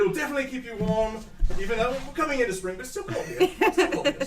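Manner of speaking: high, fast chirpy voice; high quick gnome. Acted. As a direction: chatter it out cheerfully. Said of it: "so they give it to you".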